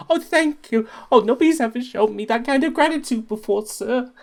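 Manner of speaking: high, eager little thank-you voice; squeaky, grateful helper. Acted. Scrappers thanking the cook, squeaky and grateful.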